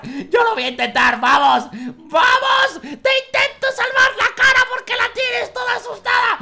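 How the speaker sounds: loud, high yells; excited shouting. Real, not acted. Gamer shouting at a patient he's saving. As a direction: push hard to save him, voice up high. Yelling while trying to save.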